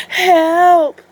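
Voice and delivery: high, loud fake cry for help; wolf shouting. Wolf yells for help to fool shopkeepers, loud fake cry. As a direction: scream it as loud as you can. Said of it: "he shouted".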